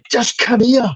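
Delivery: very high, exasperated retold summons; insist, pitch jumping right up; insistent call. Husband insisting his wife come through, high urgent call.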